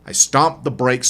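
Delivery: ordinary delivery, nothing marked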